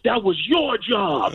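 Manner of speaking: slow, accusing yell in dad's voice; imitated angry father. Father rounds on his son after the beating, mock angry.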